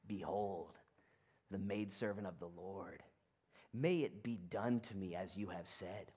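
soft, hushed and humble; bow your head and breathe it quietly; reverent hush. Retold or imitated. Mary humbly answering the angel, hushed.

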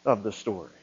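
a lightly voiced radio sign-off, low and soft; Paul Harvey. Preacher borrows Paul Harvey's line, lightly mimicking the low radio voice. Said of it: "to steal a line from Paul Harvey".

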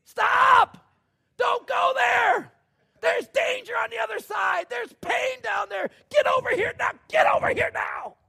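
very high, very loud yelling, slow and forceful; frantic shout. Acted. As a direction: scream at the child to get away from the edge. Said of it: "get out of the car and say".

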